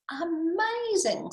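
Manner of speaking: high, drawn-out wonder; slow, lifted astonishment; amazed Gruffalo. Acted. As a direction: stretch it out in awe. Gruffalo impressed by mouse.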